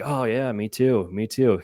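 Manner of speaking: lightly acted coy act; playing coy. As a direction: imitate someone feigning innocence, stretching the words. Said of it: "he was playing super coy".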